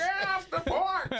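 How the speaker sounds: put-on grumpy high old codger; crotchety old man yelling off porch. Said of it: "do your old man voice".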